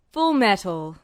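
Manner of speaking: an officer calling his subordinate to attention; stern general. Acted. General addressing Edward, firm and commanding.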